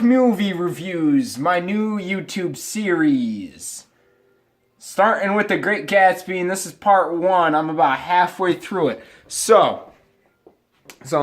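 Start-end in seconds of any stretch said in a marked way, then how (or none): none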